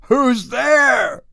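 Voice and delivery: high startled shout; frightened cry. Acted. Old man waking in the dark, calling out in fear. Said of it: "crying out".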